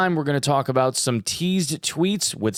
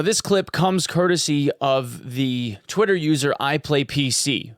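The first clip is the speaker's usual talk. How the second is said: ordinary delivery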